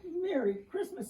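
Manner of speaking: crying out. A narrator's reading. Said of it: "he cried".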